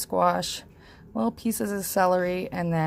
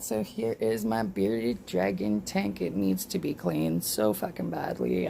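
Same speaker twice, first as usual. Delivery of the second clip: usual speaking voice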